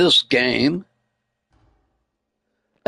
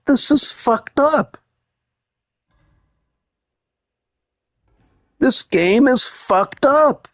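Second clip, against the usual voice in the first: outraged disbelief; 6 semitones higher, slower than usual. Retold or imitated.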